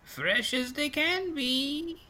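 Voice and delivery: very high, slow crone; old pastry seller. Acted. Old lady vouches for freshness, high slow crone voice. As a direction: stretch it out in a high old woman's voice.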